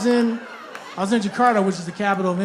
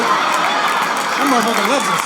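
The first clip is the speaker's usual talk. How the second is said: mock adoring; 5 dB louder, slower than usual. Fake delight at a low bar.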